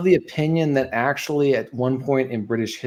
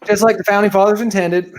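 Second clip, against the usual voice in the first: high, loud proud declaration; joking gun owner hamming up patriotism; mock patriotic; 8 semitones higher, 6 dB louder than usual. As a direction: proclaim it with mock pride.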